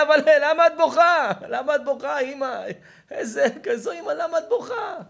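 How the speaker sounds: high, shouted plea of a son; loud, hammed-up pleading; acted anguished pleading. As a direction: cry out in dismay to your mother. Acted son pleads with crying mother.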